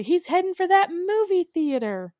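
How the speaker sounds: high, loud warning cry; mock alarm. Kids warn that the ant is coming, mock alarmed shout. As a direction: sound the alarm like a monster-movie extra.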